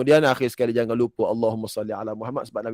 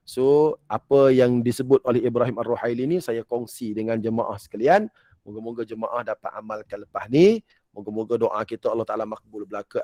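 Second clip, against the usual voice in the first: ordinary talk, nothing marked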